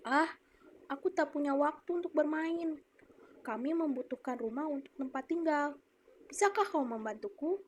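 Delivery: put-on a young man pleading with the apple tree; quoted speech. Shown as a demonstration. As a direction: ask the tree for a favour. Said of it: "Ibu akan mencontohkan".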